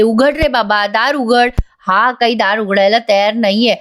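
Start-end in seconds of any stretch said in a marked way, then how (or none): none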